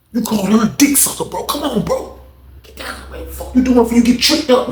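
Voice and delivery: high, hushed urgent plea; acted exasperated warning. Friend telling inmate to get down, urgent. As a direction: reason with him urgently, dropping to a whisper.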